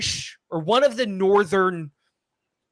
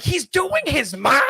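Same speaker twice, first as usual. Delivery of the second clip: high, slow mock outrage; shocked viewers; 10 semitones higher, 5 dB louder than usual. Shocked viewers mocking the twist, slow high outrage. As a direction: gasp it out like a scandalized fan. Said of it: "they're like".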